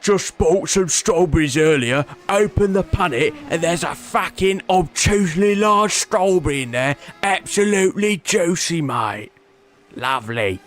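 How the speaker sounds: reading chat as a cheerful West Ham fan; London geezer accent. Acted. Reading out a viewer's strawberry message, mock London accent. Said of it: "That's what I imagine you sounded like".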